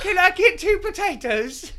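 laughing mock order.

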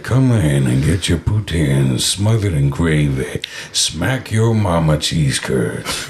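low, slow radio ad read; commercial voice. Retold or imitated. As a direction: sell it like a radio spot. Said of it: "I was like".

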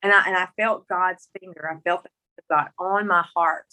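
usual speaking voice

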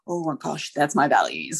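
gasp, then hushed amazement; awed realisation. Acted. Acting out a sudden realisation.

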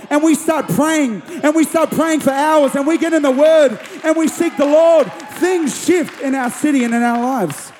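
high, driving sermon voice; impassioned preaching. Acted. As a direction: urge the congregation on, phrase after phrase. Returns to forceful preaching.

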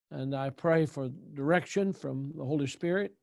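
low, hushed closing prayer voice. Acted. Pastor prays before the meeting, low and hushed.

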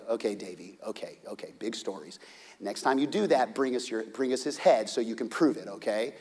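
low, soft patronizing father; calm the boy down indulgently; humoring dad. Acted.

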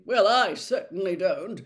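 loud, self-righteous disapproval; prim, superior retort; sanctimonious. Acted. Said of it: "said Percy sanctimoniously".

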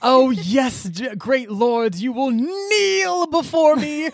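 high, slow grandiose villain command; slow theatrical villain menace. Retold or imitated. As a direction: command ancient lords grandly and slowly. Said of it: "is like".

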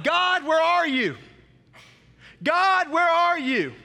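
slow, loud, high desperate crying out to God; anguished cry. Retold or imitated. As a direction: plead with God, drawing out each word. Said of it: "he cries out to God".

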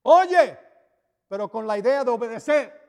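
emphatic high Oye. Acted. Emphatic preaching.

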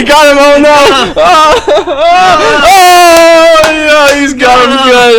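high, loud excited exclaim; high-pitched oh-no cry of glee; excited shout. Real, not acted. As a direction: yell at the screen in delight. Reacting to a video clip.

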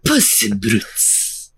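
put-on slow, purring Spanish accent; fake Spanish accent. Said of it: "This guy's faking his accent".

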